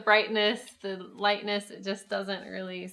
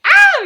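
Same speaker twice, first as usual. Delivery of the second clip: shriek; 21 dB louder than usual. Retold or imitated.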